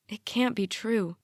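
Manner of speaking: usual speaking voice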